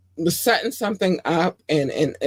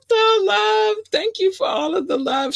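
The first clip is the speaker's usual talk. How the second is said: much higher, squealing with delight; high delighted thanks; gushing excitement. Real, not acted. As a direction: overflow with joy at the love.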